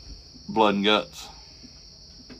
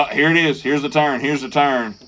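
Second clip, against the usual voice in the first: higher and louder, eager reliving of the moment; excited anticipation; 5 semitones higher, 4 dB louder than usual. Retold or imitated. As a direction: replay your own hyped reaction. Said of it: "and I was like".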